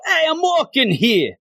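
high-pitched hammed-up New York accent; mock New York accent. Thor shows Jane his crazy New York accent. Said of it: "talking with this crazy accent".

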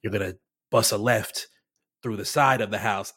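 low, even narration voice; imitated narrator, pitched well down; default TikTok narrator. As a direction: give directions as a deadpan Reels voiceover. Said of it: "He was literally in, like, the".

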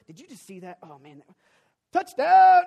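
building from low mutter to a loud touchdown yell; mock game-night excitement, exploding at touchdown; excited fan. Friends excitedly texting about game.

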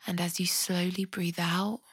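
soft, unhurried body-scan voice with long pauses; guided meditation. Acted. Podcast host guiding listeners through a compassionate body scan, soft meditation voice. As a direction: guide them gently through the body, leaving space to breathe.

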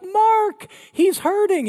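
high, loud pleading mom voice; worried mom. Retold or imitated. Mom pleading with dad for her hurting boy, high and loud. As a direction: plead for your hurting son. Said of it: "my mom's like".

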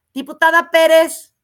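loud, clipped Diputada Pérez; mocking curt address. Chamber president calling her by half her name, mocking. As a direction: call her name out curtly to needle her. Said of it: "lo que hacía Gutiérrez Luna".

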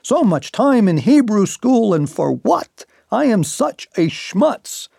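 hint of a Yiddish lament.

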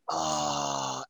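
a low, soft startled oh; lightly voiced alien noticing; mock low oh. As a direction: drop way down for a hushed oh. Aliens reacting in imagined scene.

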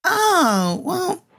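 a sudden high, sighing oh; high sympathetic oh. Acted. Wendy hearing the murderer is in a psych ward, high and pitying. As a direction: react with sweet pity. Wendy hears case outcome.

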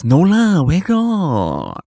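put-on high, loud, drawn-out Singlish accent; exaggerated Singlish accent. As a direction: ham up the Singlish accent with a swooping pitch. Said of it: "say it in that accent".

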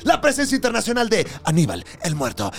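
from trailer voice building to a sung, shouted hype; hype announcer. Acted. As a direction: announce the guest like a wrestling MC. Hype intro of the guest.

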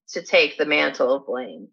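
ordinary delivery, nothing marked

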